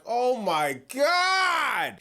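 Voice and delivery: long drawn-out groan of disbelief; exasperated reaction. Retold or imitated. Host reacts to the wrestler crying in an interview, exasperated. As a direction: stretch it out slowly in mock dismay. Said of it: "and I'm like".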